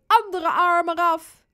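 high, loud triumphant call; mock-menacing play. Robin pretends sawing Suze's other arm off, loud playful menace.

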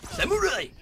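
screamed battle cry, very high and loud. Acted. Gamer yelling at the enemy, a shrill battle cry. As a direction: yell it at the top of your lungs.

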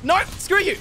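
high, loud defiance; heated outburst. Real, not acted. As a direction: yell it at the enemy. Snaps at the enemy in fight.

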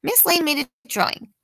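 usual speaking voice

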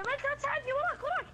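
high, loud panicked warning; alarmed yell. Real, not acted. Player wants help as enemy comes behind, alarmed yell. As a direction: cry out for help under attack. Pleading and warning under attack.

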